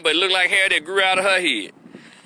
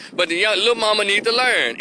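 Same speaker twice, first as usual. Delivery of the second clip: ordinary talk, nothing marked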